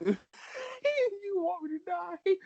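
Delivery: high, slow, laughing disbelief. Real, not acted. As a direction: squeak it out through laughter. Player laughing at GM's plan.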